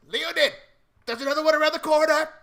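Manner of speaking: high, loud yell from round the corner; high-pitched, hurried holler to a comrade; yelling character. Acted. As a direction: shout the warning at full volume. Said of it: "you hear him yell".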